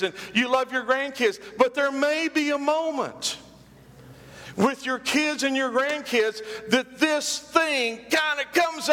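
high, ringing preacher build; climbing pulpit fervour, ending in a shout; preaching at full force. Acted.

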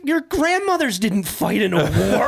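high-pitched mock maternal exasperation; incredulous mom. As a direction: imitate a baffled mother, pitch climbing high through laughter. Retold mother incredulously scolds her son.

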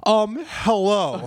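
slow, hammed-up foreign accent hello; mock Afghan caller. Imitating a caller from Afghanistan in a mock Afghan accent. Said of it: "and he's just like".

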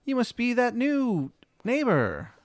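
put-on high-pitched little girl voice. Little girl in red greeting the new neighbor, high voice. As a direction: pitch it way up like a young child. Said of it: "little girl".

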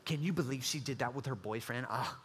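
hushed, catty gossip behind her back; low, lighter voice trading a juicy rumor. Retold or imitated.

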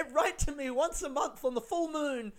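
high-pitched farewell breaking into laughter; hammed-up send-off plea through giggles; war send-off. Woman sending him off to war, high mock farewell. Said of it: "she's sending him off to war".

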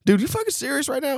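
high, incredulous challenge; retold disbelief. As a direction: re-enact your baffled challenge, pitched high. Retold disbelief during standoff.

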